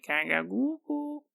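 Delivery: soft, mock baby babble. Changeling baby babbles innocently at its mother, soft mock baby talk. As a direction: coo it like an infant, lightly. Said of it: "And the baby's like".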